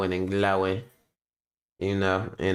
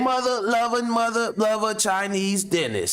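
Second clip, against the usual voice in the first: censored TV dub voice; 10 semitones higher than usual. Retold or imitated.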